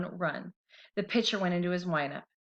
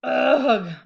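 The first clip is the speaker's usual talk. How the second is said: loud pained groan of a tooth; cartoon tooth groaning; weak moan; 5 semitones higher, 10 dB louder than usual. A narrator's reading. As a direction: groan it out in pain. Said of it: "moaned the tooth weakly".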